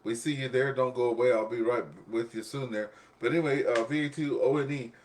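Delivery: ordinary delivery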